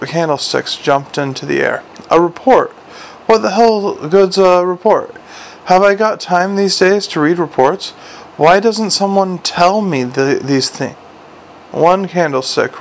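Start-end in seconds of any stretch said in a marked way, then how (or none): 2.1-11.0 exasperated, higher-pitched rant; angry outburst. A narrator's reading. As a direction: demand answers, pitched up and indignant. Angry commander berates his officer.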